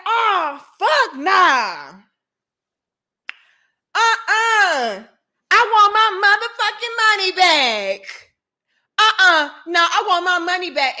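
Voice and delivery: high, loud outraged demand; indignant outburst, pitched high and loud; mad customer. Retold or imitated. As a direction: imitate a furious customer kicking off. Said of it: "got so mad at me".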